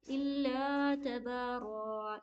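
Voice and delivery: very slow, तबारा ending without the n; stretched बा, no रन. Shown as a demonstration. Teacher showing the pause form of तबारा. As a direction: read इल्ला तबारा slowly, stop on रा. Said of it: "यहाँ पे रन नहीं पढ़ेंगे".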